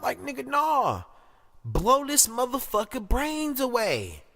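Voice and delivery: high, exasperated outburst; outraged rebuttal. Real, not acted. Commentator rejecting a mere arrest, high-pitched outrage. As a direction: push the pitch up in heated disbelief. Rejecting the alarm, demanding action.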